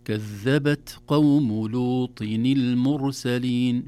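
slow, loud careful recitation. Shown as a demonstration. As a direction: recite the whole verse slowly and clearly. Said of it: "هذا يسمى همس".

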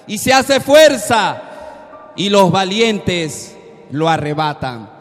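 ordinary delivery

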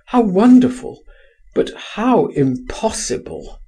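high-pitched marvelling, easing into protest; wistful exclamation. A narrator's reading. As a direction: cry of wonder, high on the first words. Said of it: "he cried".

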